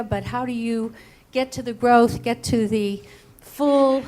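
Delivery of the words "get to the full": normal talking voice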